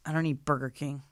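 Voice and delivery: quiet, low, dry reply; flat refusal. Retold or imitated. Homeless man refusing the gift card, quiet and dry. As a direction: turn it down without interest. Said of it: "he goes".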